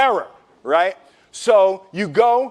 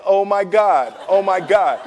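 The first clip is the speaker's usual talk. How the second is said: ordinary delivery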